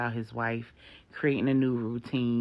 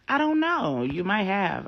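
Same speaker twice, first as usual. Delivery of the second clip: much higher, louder breezy pastor; airy deflection; 8 semitones higher, 6 dB louder than usual. Acted. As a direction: brush it off, high and bright.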